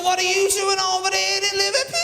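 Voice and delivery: put-on high, loud Liverpool accent; Scouse accent. Scouse bloke at the station mocking a City fan, Liverpool accent. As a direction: go full comic Liverpudlian. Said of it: "he said".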